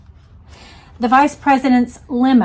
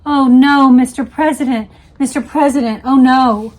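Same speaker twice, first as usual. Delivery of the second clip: urgent, pained cry of oh no; anguished repeating; 4 semitones higher, 8 dB louder than usual. Retold or imitated. Aide leaning over the shot president, anguished. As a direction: plead over and over in shock. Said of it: "kept repeating over and over".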